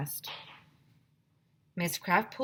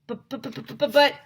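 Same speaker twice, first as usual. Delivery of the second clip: stutter; 7 semitones higher, 6 dB louder than usual. Acted. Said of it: "I stuttered".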